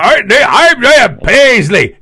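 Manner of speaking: loud, high, slow booming preacher voice; slow, loud Northern Irish roar, pitched well up; Ian Paisley impression. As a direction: bellow it like a fiery Northern Irish firebrand. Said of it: "we could do Paisley impressions all day".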